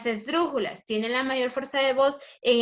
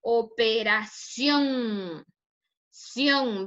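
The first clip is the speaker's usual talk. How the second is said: "Operación" slowly, final "ción" drawn out, then repeated alone; single-emission demo; much slower than usual. Shown as a demonstration. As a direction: stretch the end of "Operación", then isolate "Sion". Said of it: "hacemos una sola emisión de voz".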